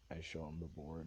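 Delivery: usual speaking voice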